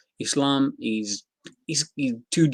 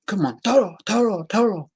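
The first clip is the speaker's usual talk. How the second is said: high, swooping call to a bull; taunting matador; 9 semitones higher, 3 dB louder than usual. Acted. Counter fighter baiting his opponent, like a taunting matador. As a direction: goad the bull into charging, higher pitched. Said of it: "you've got a counter fighter who's going".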